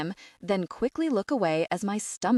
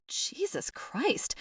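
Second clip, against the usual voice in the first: whispered in disbelief, slow; a slow disbelieving whisper to no one; under-breath whisper; 8 semitones higher, 6 dB quieter, much slower than usual. Acted. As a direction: breathe it out to yourself, slow and unvoiced. Muttered aside in disbelief.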